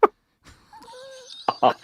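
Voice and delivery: breathy whispered ninja cry. Pretend. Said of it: "you gotta throw in a little".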